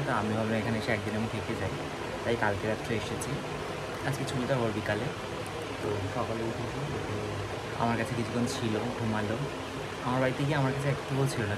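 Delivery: speaking softly. Real, not acted. Vlogger talks to viewers softly while the baby sleeps. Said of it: "আস্তে আস্তে কথা বলছি".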